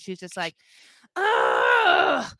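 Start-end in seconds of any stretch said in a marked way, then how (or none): 1.1-2.3 loud, high mock groan; hammed-up fed-up "ugh"; exasperated groan. Retold exasperated reply to pleading.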